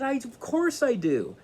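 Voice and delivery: high, urgent plea; the seeker pleading with the wise man, high and urgent; desperate insistence. Retold or imitated. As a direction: insist with all his heart.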